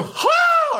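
screamed high "ha" whoop; sudden high mock scream; Sting. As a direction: imitate a wrestler's odd yelping cry. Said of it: "It's like he's just screaming".

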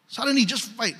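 normal talking voice

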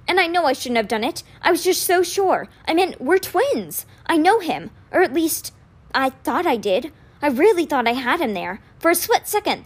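put-on anxious, regretful young voice; upset young man. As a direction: pour it out in remorse. Alex explaining, upset.

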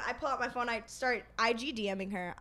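usual speaking voice

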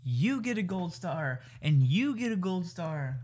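slow, sing-out mock award announcement; mock giveaway host. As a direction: hand out prizes grandly, stretching every word. Playful mock giveaway of gold stars.

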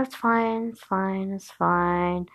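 soft, trailing-off imitated reassurance; hushed reassurance. Man reassuring the hesitant narrator, hushed.

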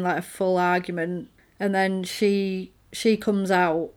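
usual speaking voice

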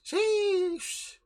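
long, high-pitched "sheesh"; drawn-out sheesh. Real, not acted. Podcaster reacting to a dungeon show, high drawn-out sheesh. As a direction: stretch out a high, held sheesh.

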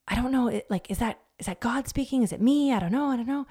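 fret, unsure whose voice it is; mock confusion. Women doubting whether God speaks, flustered. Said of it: "we get in this place where we're like".

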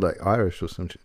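normal talking voice

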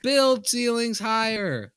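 high, slow, loud mock demand; slow proclamation. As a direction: declare it like a slogan. Mock demand, a funny complaint.

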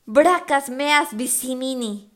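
shout. A narrator's reading. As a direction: shout the Latin slowly. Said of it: "le grité".

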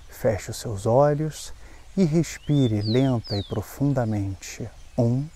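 slow, calm breathing guide; slow breath counting. Acted. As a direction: count the breaths slowly and gently.

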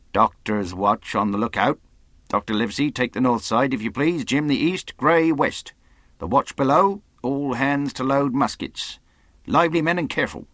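brisk commands rising in pitch; giving orders. A narrator's reading. Captain posts his men to the lookout, firm orders. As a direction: deal out orders to the crew.

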